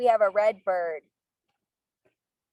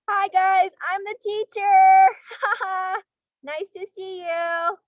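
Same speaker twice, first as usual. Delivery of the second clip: high, loud, slow cheery greeting; excited kindergarten teacher; 8 semitones higher, 5 dB louder, slower than usual. Pretend. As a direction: beam at the little kids. Playing the teacher in book.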